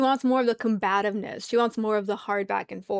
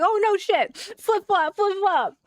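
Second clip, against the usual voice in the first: higher sing-song mock of his reversal; mocking flip-flopper; 9 semitones higher, slower than usual. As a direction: tease him with a chanting flip flop. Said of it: "he was just like".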